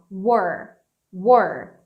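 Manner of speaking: we're said like were, one syllable. Shown as a demonstration. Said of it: "pode ser pronunciada igual isso aqui". (we're pronunciado como were)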